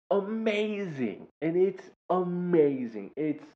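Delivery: high, drawn-out marvelling; gushing amazement. Real, not acted. Teacher raves about student's impersonations, high drawn-out gushing. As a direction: stretch it out high in wonder.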